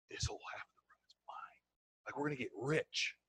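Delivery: hushed, conspiratorial brag; excited whisper. Acted. New recruit to his mentor at back of room, whispering. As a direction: whisper it so the speaker won't hear.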